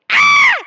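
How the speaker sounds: sudden high shriek of fright; mock scream. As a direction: shriek at the sight of a spider. Sudden spider sighting at home.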